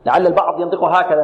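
ordinary delivery

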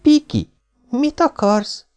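high surprised call; half-laughing call. A narrator's reading. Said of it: "félig nevetve kiabálta".

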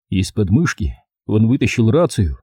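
plain reading voice

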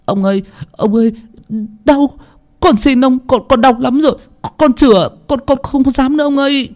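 very high, pained begging; terrified boy's high wailing plea; screamed pleading. A narrator's reading. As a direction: stammer for mercy while being whipped. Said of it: "tiếng hét van xin của thằng Thổ".

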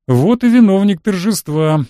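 lightly voiced senior cleric greeting; greeting bishop. A narrator's reading. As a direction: hail the guest of honour. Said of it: "поприветствовал меня Владыка".

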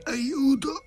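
very high choked cry for help; thin high-pitched panicked cry; attempted scream. A narrator's reading. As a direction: try to scream while being throttled. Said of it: "tentò di gridare".

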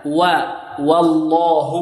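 و held alone slowly, then والله with a light waw; waw drill. Shown as a demonstration. Teacher isolating the waw for students, slow. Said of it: "لا تفخيم ولا إمالة".

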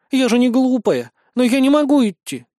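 put-on pouty little girl, loud whine; whiny spoiled pouty drawl. A narrator's reading. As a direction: sulk and whine like an offended child. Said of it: "Девочка надула губы". (капризный обиженный детский голос)

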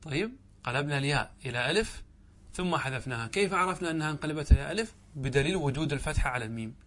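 ordinary talk, nothing marked

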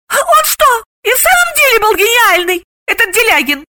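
very high, curious question; intrigued. A narrator's reading. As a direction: ask with lively interest. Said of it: "заинтересовалась Галина".

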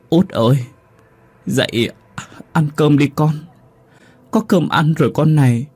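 grief-stricken lament, voice rising; crying mother. A narrator's reading. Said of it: "vừa khóc vừa nói". (giọng mẹ vừa khóc vừa gọi con đã mất)

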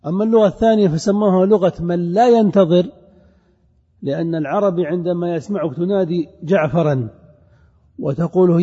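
ordinary delivery

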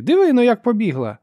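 high, loud cry on sighting prey; woman shouting. A narrator's reading. Sister spots fleeing Ferro and shouts. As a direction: yell it to alert others. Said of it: "закричала жінка".

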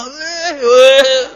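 mock Korean shouting. Preacher imitating a guest shouting over loud wedding music, in a mock Korean yell. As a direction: yell it like everyone in a Korean drama. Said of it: "kayak orang Korea".

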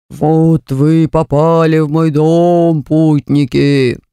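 low, slow menacing portrait voice; sinister drawl. Acted. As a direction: drag it out like a haunted painting. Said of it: "зловеще протянула одна из картин".

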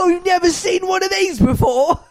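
high shouted British jeer; Carol. Pretend. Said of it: "she's yelling at the children".

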